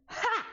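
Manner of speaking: sharp high mocking laugh bark; one snide burst; mock loud laugh. Mom laughing at coworker, sharp high mock laugh. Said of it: "was more like".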